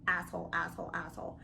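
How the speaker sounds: asshole vibe. Retold or imitated. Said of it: "he was already coming off like".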